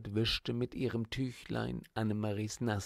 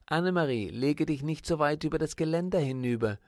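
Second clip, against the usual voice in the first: louder, higher and quick, calling out a warning; 5 semitones higher, 5 dB louder, faster than usual. Acted. Teacher warns the girl leaning over the railing, loud and quick. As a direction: call her back from the railing, fast. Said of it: "rief Fräulein Hering warnend".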